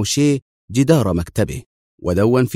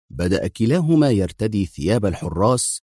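ordinary delivery, nothing marked

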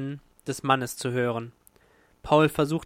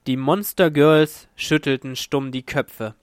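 ordinary delivery, nothing marked

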